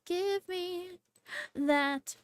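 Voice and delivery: ordinary talk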